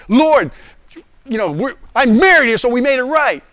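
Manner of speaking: loud, high-pitched pleading excuse; acted indignant objection; protesting. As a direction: argue back at God, high and loud. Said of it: "listen to my responses".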